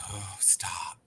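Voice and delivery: whisper. Retold or imitated. Pleading with crying cats.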